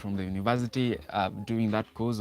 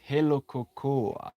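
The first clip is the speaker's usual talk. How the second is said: reading a comment; 4 semitones higher, slower than usual. Retold or imitated. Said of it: "Surya says".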